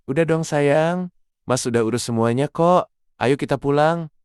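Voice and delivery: tender reassurance. Acted. Husband soothing tired wife.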